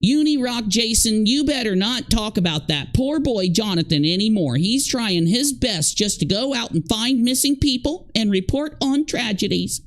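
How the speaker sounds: scolding mother. Retold or imitated. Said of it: "call me and say".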